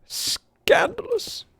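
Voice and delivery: very high, very slow hammed-up scandalised cry; mock scandalised. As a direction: drag out the outrage theatrically. Mock outrage at cheating.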